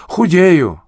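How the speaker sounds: puffing out a reply mid-run; panting. A narrator's reading. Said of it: "со свистом дышал через нос".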